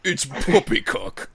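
slow, mock-posh British "poppycock"; awful British accent. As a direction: play a stuffy Englishman scoffing. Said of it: "in the most awful British accent you could do".

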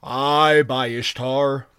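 loud booming giant; booming thunder voice. Acted. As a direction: boom it out slowly like a giant. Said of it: "boomed the giant".

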